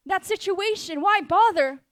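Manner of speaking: high, scornful dismissal; mocking. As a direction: jeer like the doubters. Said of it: "They're mocking you".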